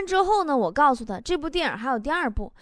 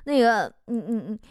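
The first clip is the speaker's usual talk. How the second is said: mock hesitant stutter with repeated starts; stuck, halting stammer. Friend on the phone asking a favour, acted halting stammer. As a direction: stumble and restart, barely getting the request out. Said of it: "强子卡了老半天说".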